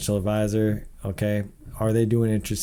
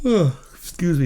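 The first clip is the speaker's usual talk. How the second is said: real yawn dragging through the words; 8 semitones higher, 6 dB louder than usual. Real, not acted. Tired host yawning while reading figures. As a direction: stretch the words out mid-yawn. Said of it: "Sorry, I keep yawning".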